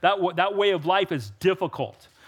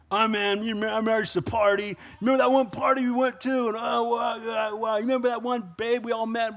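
lightly voiced boastful old friend, fast at first; mock reminiscing about wild parties; a hint of a nostalgic party boaster; bragging partygoer; 4 semitones higher than usual. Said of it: "they will brag about it or revel in it".

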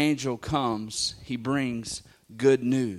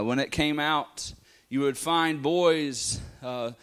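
ordinary delivery

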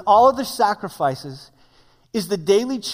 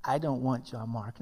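flat refusal; 8 semitones lower, 12 dB quieter than usual. Retold or imitated. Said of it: "Paul looks at him and he says".

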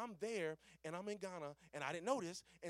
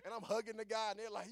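ordinary talk, nothing marked

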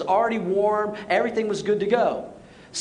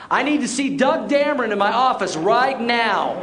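raised, emphatic mock-angry order; stern summons; 4 semitones higher, 4 dB louder than usual. Pastor summoning youth director after prayer, sternly angry. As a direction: call out the culprit publicly, pitched up.

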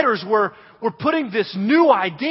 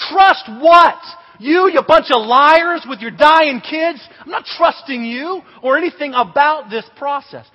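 loud, higher mock outrage; outraged disbelief; 5 semitones higher, 7 dB louder than usual. Camper accusing the counselors of lying, loud mock outrage. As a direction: throw the accusation back, hammed-up. Kid's outraged answer to trust.

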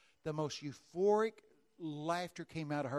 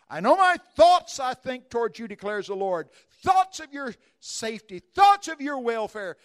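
much louder, higher, slow; thundering scripture reading; 8 semitones higher, 19 dB louder than usual. Acted. Preacher proclaiming Jeremiah to the congregation, thundering. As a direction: proclaim the verse to the crowd.

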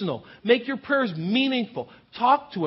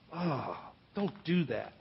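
much softer prim tut-tut; a hushed scandalised wince; disapproving; 6 semitones lower, 12 dB quieter than usual. Retold or imitated. Religious people reacting to Jesus, a hushed disapproving tut. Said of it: "they'd kind of raise their shoulders".

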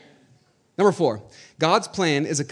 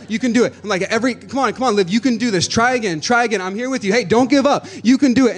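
cheering on; 7 semitones higher, 4 dB louder than usual. Retold or imitated. Father cheering daughter on.

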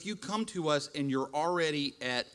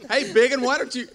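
higher and louder nagging, hammed up; mock scolding wife; 8 semitones higher, 8 dB louder than usual. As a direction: play the cross wife barking orders at her husband. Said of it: "in that tone of voice".

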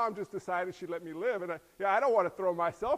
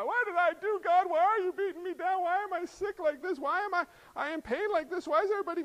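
higher, whining lament; mock anguished complainer; 9 semitones higher than usual. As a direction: plead with God in anguish. Suffering believer complaining to God.

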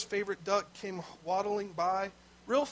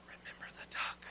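soft, sly whisper in his ear; 13 dB quieter than usual. Retold or imitated. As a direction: hiss the reminder close to his ear. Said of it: "she whispered really closely in his ear".

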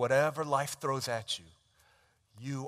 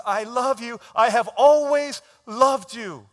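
much higher and much louder, slow and stretched; preached proclamation; 10 semitones higher, 15 dB louder, slower than usual. Acted. Preacher voices God's love to the congregation, full-force shout. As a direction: ring it out to the back row.

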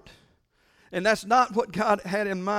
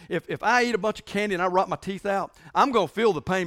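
ordinary delivery

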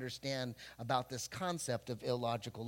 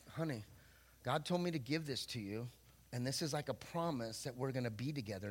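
softer, sincere words to honey; soft tender promise; 4 semitones lower, 7 dB quieter than usual. Retold or imitated. Young man giving his girlfriend a promise ring, softly sincere. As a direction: tell her gently it's a promise.

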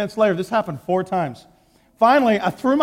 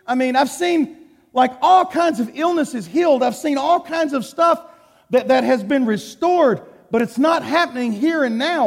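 higher, pleading complaint peaking on a high Why; frustrated plea to God; 6 semitones higher than usual. Retold or imitated. Preacher voicing his anger at God over unhealed illness, higher and pleading. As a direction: challenge God, climbing up into an indignant Why. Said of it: "I started getting mad at God".

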